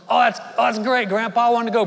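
can't wait to look at guns; excited boy. Retold or imitated. Boy thrilled by grandpa's town trip, excited. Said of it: "And Michael goes".

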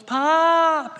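very high, loud call across the auditorium; child's scream. Retold or imitated. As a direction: shout "Pop!" like an excited grandchild. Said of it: "she will scream".

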